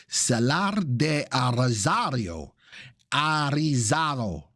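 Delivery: slow, drawn-out Spanish pronunciation; hammed-up Spanish accent. Shown as a demonstration. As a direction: roll it out slowly in a playful Spanish accent. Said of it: "That's my Spanish now".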